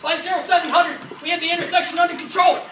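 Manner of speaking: loud, high combat storytelling. Acted. Soldier recalling the intersection being taken, loudly. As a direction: tell it over the noise of battle.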